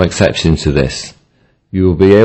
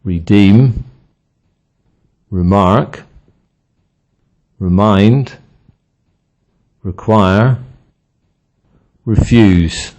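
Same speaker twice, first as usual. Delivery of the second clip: weak "re", strong end: reDEEM, reMARK, reMIND, reQUIRE, reFUSE; second-syllable stress; slower than usual. Shown as a demonstration. Teacher giving examples of re as part of the verb, second syllable stressed. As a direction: lean on the syllable after "re". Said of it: "Stress the other syllable if re is part of".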